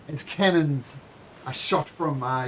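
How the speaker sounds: usual speaking voice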